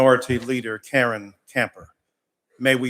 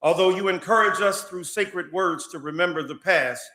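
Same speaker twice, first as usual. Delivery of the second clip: public prayer; 5 semitones higher than usual. Real, not acted. Pastor praying before the state House, impassioned preaching prayer. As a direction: pray it from the pulpit, building fire against injustice.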